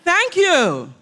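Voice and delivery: high, put-on "Thank you."; mock response. Shown as a demonstration. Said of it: "you're going to say".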